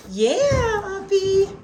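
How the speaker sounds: high, bright praise to the dog; excited pet greeting. Real, not acted. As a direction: cheer the dog on.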